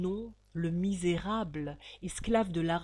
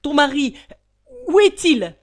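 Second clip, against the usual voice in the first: loud, high panicked demand; bursting in, out of breath; breathless shout; 8 semitones higher, 16 dB louder than usual. Acted. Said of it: "tout essoufflé".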